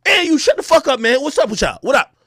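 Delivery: loud, high confrontational challenge; angry outburst. Acted. Ranter squaring up to everyone in the hallway, angry. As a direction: call them out, pitch jumping up. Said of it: "once I get mad".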